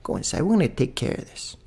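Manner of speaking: soft, urgent hushed instructions; hushed order. Retold or imitated. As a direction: quietly send the women and kids inside. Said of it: "They told the women".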